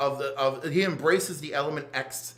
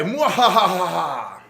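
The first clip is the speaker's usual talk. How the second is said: slow loud evil laugh; evil villain cackle; 2 semitones higher, 8 dB louder, much slower than usual. Retold or imitated. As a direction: cackle like a mad scientist. Villain laugh ending issue.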